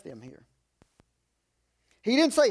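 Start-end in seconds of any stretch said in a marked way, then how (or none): none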